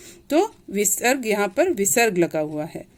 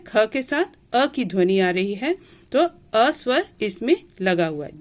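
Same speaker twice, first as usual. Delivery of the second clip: ordinary talk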